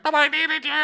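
high-pitched hammed-up wail; exaggerated tearful squeal, imitating a quarrel; mock crying. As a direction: whine it out like a mock sob. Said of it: "they're, they're, like, crying".

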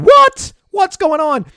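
sudden high shocked yell; high, loud "What? What's going on?"; retold yell. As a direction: shout it at the screen in disbelief. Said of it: "I'm yelling at my monitor saying".

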